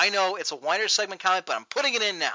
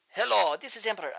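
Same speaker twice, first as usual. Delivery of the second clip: attempted Chinese accent, lightly done; bad Chinese accent; 3 semitones higher than usual. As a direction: badly fake a Chinese accent. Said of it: "I can't do a Chinese accent".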